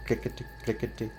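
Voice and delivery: quiet, quick sound-effect voice; soft rattling sound. A narrator's reading. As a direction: hush it down to a tiny rattle. Coins rattling in tin box.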